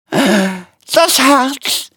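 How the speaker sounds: high, strained cry of pain; Aufschrei. A narrator's reading. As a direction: cry out as if shot. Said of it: "schrie er". (hoher, gequälter Aufschrei)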